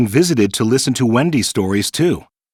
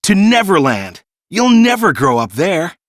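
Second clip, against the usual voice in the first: higher, louder rallying cry; eager call; 5 semitones higher, 4 dB louder than usual. A narrator's reading. Peter urging the children to come, eager loud call. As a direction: sweep them along with excitement. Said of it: "Peter called out".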